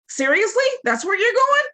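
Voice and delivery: high, disbelieving rise; can you believe this guy; mock disbelief at Balaam's answer; incredulous. Real, not acted. Pastor reacts to Balaam's reply.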